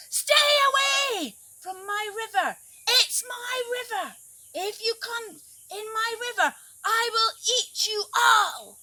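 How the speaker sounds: high, loud bellowing threat; angry shout. Acted. Crocodile shouts at the forest creatures to stay away, furious. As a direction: roar it to scare everyone off. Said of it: "he shouted to the creatures of the forest".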